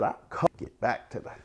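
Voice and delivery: usual speaking voice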